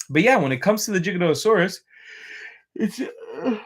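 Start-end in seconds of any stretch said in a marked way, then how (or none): 2.7-3.6 mid-yawn; speaking through a yawn. Real, not acted. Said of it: "I think I have, like, chronic yawning".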